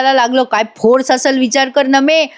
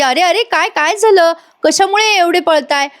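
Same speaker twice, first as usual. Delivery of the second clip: puzzled woman; 7 semitones higher than usual. Acted. Avani rushes in puzzled.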